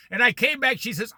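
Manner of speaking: normal talking voice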